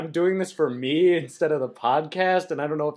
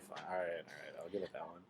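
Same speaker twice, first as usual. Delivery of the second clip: mumbled concession; 9 semitones lower, 19 dB quieter, much faster than usual. Real, not acted. Conceding grudgingly.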